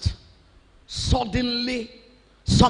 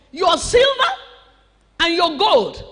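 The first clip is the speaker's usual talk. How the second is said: booming demand; 5 semitones higher, 4 dB louder than usual. Acted. Preacher acting the demand again.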